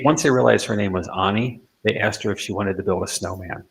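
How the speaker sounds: ordinary delivery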